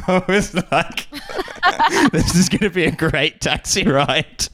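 high-pitched, helpless laughing delivery. Real, not acted. Passenger delighted by the rude cabbie, laughing while talking. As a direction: laugh through the words.

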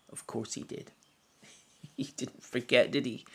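breathy whisper to himself; whispered aside. Real, not acted. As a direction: drop to a whisper, knowing. Quiet aside after the story.

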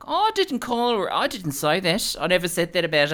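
high-pitched mock Australian housewife; Australian accent. Imitating Australian housewives denying what they said, Australian accent. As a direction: protest in a put-on Australian accent. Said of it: "everything is funnier in that accent".

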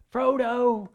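a persistent cry after him; imitating Sam calling out; calling Frodo. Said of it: "But Sam persists".

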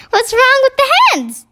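high, indignant Queen; surprised question. A narrator's reading. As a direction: ask in high-pitched astonishment. Queen's startled question in story.

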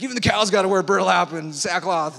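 loud, odd-voiced proclamation of the king; mock royal decree. Preacher mocking the king's decree, loud and silly. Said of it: "He's like".

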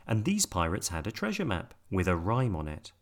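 ordinary delivery

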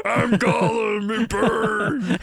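high, slow, shouted creature cry; Gollum impression. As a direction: yell like Gollum burning. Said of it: "That's a good Gollum impression".

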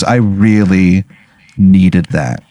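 slow, low, choked-up thanks; teary gratitude. Retold or imitated. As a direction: hold back tears as you thank him. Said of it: "you can see him kinda tear up".

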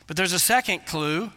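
normal talking voice

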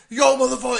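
loud New York tough-guy imitation; mock a New York loudmouth; high, loud fake-tough heckle; wannabe tough guy. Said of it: "typical wannabe tough guy from New York".

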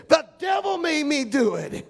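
high shouted comic catchphrase; high-pitched Geraldine-style shout; Flip Wilson impression. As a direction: channel the old comedian's whine. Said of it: "like old Flip Wilson used to say".

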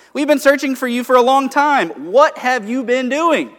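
high, loud, worried-sick scolding; exasperated parent scolding. Retold or imitated. Mary scolding her missing twelve-year-old son, loud and exasperated. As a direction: let the worried parent's relief burst out as a scold. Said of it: "it would go something like".

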